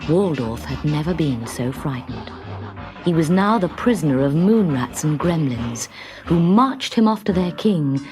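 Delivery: ordinary delivery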